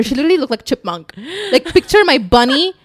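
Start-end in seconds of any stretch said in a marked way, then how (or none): none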